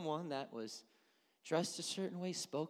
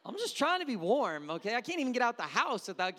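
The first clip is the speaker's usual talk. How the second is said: much louder, higher protest; mock indignant; 10 semitones higher, 11 dB louder than usual. Real, not acted. Pastor comically defending his sweater to the congregation, louder. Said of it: "mock defensive".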